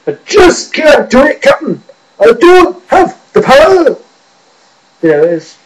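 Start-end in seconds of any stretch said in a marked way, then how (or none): 0.1-4.0 high, loud, frantic Scottish engineer; hammed-up Scottish panic; Scotty. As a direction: shout it slowly as an over-the-top Scot. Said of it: "Scotty from Star Trek".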